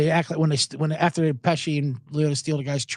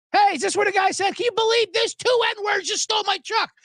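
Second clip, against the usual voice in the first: very high-pitched imitation of rowdy customers; high, strained mock shouting; mock yell. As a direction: mimic customers yelling a movie line. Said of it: "they just yell the N-word".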